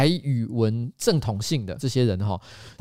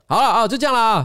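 calling a halt; 8 semitones higher, 5 dB louder than usual. Real, not acted. Cuts the topic short, calling out.

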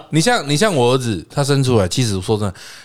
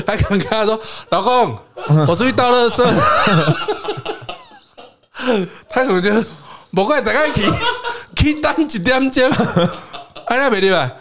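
higher pitched, giggling through the story; laughing; 10 semitones higher than usual. Real, not acted. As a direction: tell the joke while cracking up. Imagining wife's excuse, laughing.

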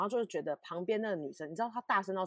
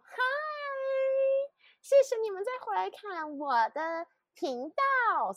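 much higher, slow, beaming channel greeting; bubbly YouTuber intro; 4 dB louder than usual. Retold or imitated. Mocking YouTubers' energetic openers, bright YouTuber greeting. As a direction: gush like an upbeat YouTuber welcoming viewers back. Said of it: "那种energy".

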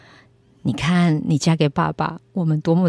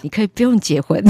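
laughing through the imagined words; 8 semitones higher, 10 dB louder than usual. Retold or imitated. Imagining God telling her she needn't marry, laughing. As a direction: giggle out God's imagined answer. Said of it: "突然跟我说".

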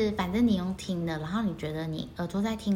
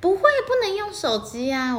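high, louder protest; insistent; 11 semitones higher, 7 dB louder than usual. Real, not acted. Host insisting to co-host she never uses her phone. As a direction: push back firmly.

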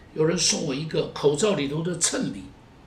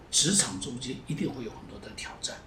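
压低成耳语; 像分享秘密一样轻说; hushed; 6 semitones lower, 8 dB quieter, faster than usual. Real, not acted. 讲职场挑战. (压低声音提醒信徒职场挑战)

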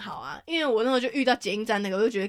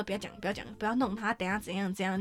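retold hushed pleading; 2 semitones lower, 9 dB quieter than usual. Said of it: "然后玉成就说".

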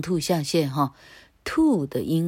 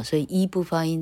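ordinary delivery

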